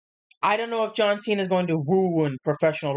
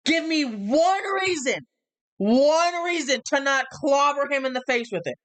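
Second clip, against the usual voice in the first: impassioned wrestling promo; 6 semitones higher than usual. Retold or imitated. Acting out Cody's heated promo.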